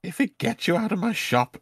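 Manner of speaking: high-pitched, fed-up wizard; exasperated wizard. Acted. Wizard to unwelcome customer in his shop, exasperated. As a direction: give in to be rid of them, high-voiced.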